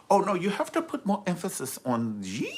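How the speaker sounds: high-pitched mock teacher correction; imitated Mandarin teacher. Mandarin teacher correcting the boyfriend, high mock teacher voice. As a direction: correct the pupil in a high voice, stretching the last sound. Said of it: "hear the teacher's like".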